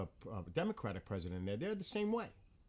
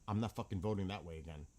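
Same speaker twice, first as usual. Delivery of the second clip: usual speaking voice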